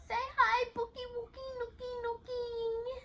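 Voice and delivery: put-on high cutesy baby voice; baby talk. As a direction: coo it like to a baby.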